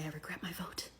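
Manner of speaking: hushed mock-penitent whisper of a voter. Mocking a regretful voter in a hushed whisper. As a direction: breathe the regret in a fake-sorry whisper. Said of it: "and they say".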